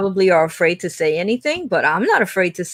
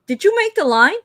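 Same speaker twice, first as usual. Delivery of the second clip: high-pitched clerk asking; clerk questioning; 11 semitones higher than usual. Retold or imitated. Clerk at the window, high questioning. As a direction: raise it up, questioning. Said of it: "he goes".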